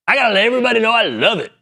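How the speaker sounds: high, fast gushing mock voice; mock commenter. Host mocking gushing commenters, high fast mock voice. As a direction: parody a gushing fan.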